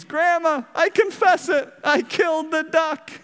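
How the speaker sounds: put-on high, strained boy's voice blurting it out; desperate confession. Guilty boy blurting out his confession to Grandma, high and desperate. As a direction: burst out in a pleading, childish cry. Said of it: "Johnny proclaims".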